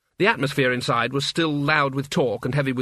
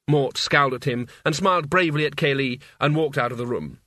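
ordinary delivery, nothing marked

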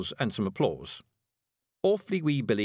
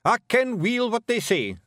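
high Scots snap, a little louder and slow; snapped; 13 semitones higher, 5 dB louder than usual. A narrator's reading. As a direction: cut the wee one off sharply. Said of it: "snapped Rob Anybody".

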